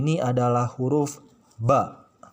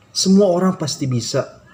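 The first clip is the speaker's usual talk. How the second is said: encouraging; 6 semitones higher, 6 dB louder than usual. Real, not acted. Teacher encouraging beginner students.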